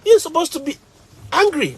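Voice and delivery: put-on high, teasing kids' voice; mocking students. Students mocking the smiling teacher, high teasing. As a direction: tease him like cheeky students. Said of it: "they'll say".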